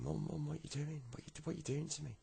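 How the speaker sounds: high, hurried pleading; alarmed pleading. Acted. Boy to mum who is throwing him out, alarmed pleading.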